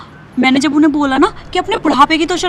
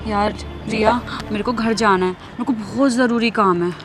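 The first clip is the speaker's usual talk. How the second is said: subdued; 4 semitones lower, 7 dB quieter than usual. Acted. Shaken friend makes excuse to leave.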